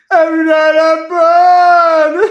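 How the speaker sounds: high, loud, slow wailing screech; hammed-up Crow impression. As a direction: wail it like a tormented ghost. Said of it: "I'm doing my Crow impersonation".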